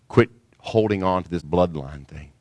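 normal talking voice

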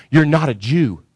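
ordinary talk, nothing marked

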